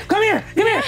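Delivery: high, loud excited beckoning; urgent calling. Acted. Zelda summoning Danielle to come along, loud and urgent. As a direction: call your friend over insistently.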